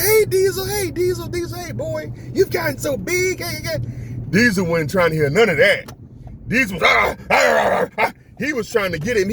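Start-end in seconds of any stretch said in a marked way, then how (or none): 0.0-3.8 very high sing-out to a dog; coaxing a dog. Retold or imitated. Retold friendly greeting to dog. 6.8-8.1 imitated dog barking. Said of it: "He was barking his ass".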